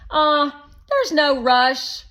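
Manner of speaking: put-on high, loud pushy boy; Shake voice. A narrator's reading. Narrator voicing Shake, a loud, high pushy boy. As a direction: play the bossy guest kid, loud and high.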